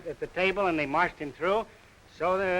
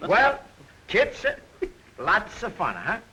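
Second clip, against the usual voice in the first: put-on comic Greek accent; 2 semitones higher than usual. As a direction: play a jolly Greek immigrant pitching the slogan. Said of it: "how about a little example of that dialect you talk of".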